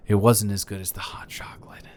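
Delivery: a playful, hushed confession; whispered tease. Real, not acted. Boyfriend confessing the kiss lost to cocoa, teasing whisper. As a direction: whisper it like a guilty secret.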